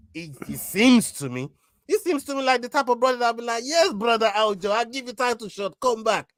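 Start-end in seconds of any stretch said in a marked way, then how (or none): 3.6-6.3 higher-pitched Georgian accent imitation; Georgian-accented English, pitched up. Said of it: "Georgian accent didn't really marry up".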